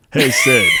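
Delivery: loud put-on cantina regular; Patrick Warburton. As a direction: call out in a big celebrity-impression voice. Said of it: "some guy who runs a cantina".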